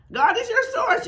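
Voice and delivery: "your" jumps far up in pitch; high emphatic repeat. Shown as a demonstration. Teacher driving the point home to listeners. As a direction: fling "your source" up high. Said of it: "repeats the line".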